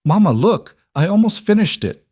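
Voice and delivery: high, loud eager voice; excited child. Acted. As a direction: show it off with delight. Child shows handiwork excitedly.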